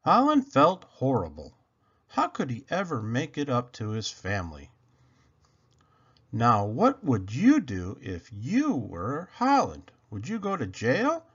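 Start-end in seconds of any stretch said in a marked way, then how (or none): none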